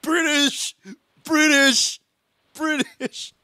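high-pitched repeated jeer; mock taunting cry. Player bullying the British guy, high taunting cry. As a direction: call it out high, over and over.

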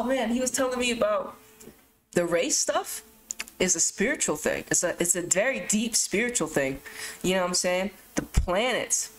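usual speaking voice

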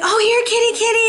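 very high cutesy kitty call; calling a cat. Acted. Person trying to win over a cat, high-pitched coaxing. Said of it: "look at a cat and be like".

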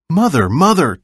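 calling out loud and high for mother; boy shouting for his mother in alarm; crying out. A narrator's reading. Older brother calling to mother, urgent cry. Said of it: "Chen's brother cried".

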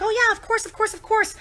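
high, eager quoted yes; eager agreement. Retold or imitated. Friend readily agreeing to be Snapchatted, eager agreement. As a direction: rattle off a keen, high-pitched yes. Acting out her eager consent.

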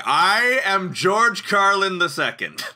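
slow, high, pompous George Carlin wannabe; mock-grand rookie comic proclaiming greatness; grandiose comic. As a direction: boom it out like a self-important comedy legend. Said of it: "little baby two-week-in [name] was like".

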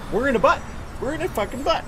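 high-pitched exclamation; rising disbelief. Real, not acted. Repeats it with rising alarm.

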